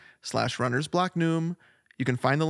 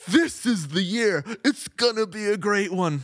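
slow, high, hammed-up optimism; mock excitement; 6 semitones higher than usual. Host imitating their new year hopes, mock excitement. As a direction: stretch out the big hopeful proclamation, pitched high. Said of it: "we're like".

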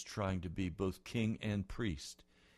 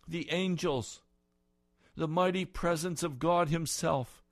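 climactic preaching; 9 semitones higher, 9 dB louder than usual. Real, not acted. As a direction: lift the room to the glory of God. Preaching at full force.

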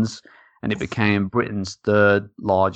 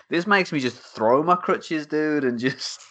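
higher, hammed-up poor crippled boy; mock Tiny Tim; 7 semitones higher, slower than usual. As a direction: play the grateful sickly child, lightly overdone. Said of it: "All right, Timmy".

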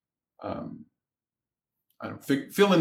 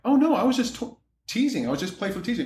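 imitated higher-pitched excuse; protesting woman's excuse; 8 semitones higher, faster than usual. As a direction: imitate her hurriedly explaining herself, voice pitched up. Acts woman excusing herself as teasing.

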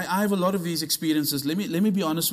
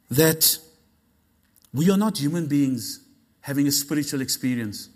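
low, deliberate delivery; unhurried, grave teaching tone; measured statement; 3 semitones lower than usual. Shown as a demonstration. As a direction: lay it out calmly, with weight. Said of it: "Let me say that again".

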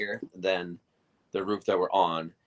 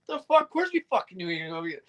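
incredulous; 10 semitones higher, faster than usual. Retold or imitated. Incredulous aside about Rory.

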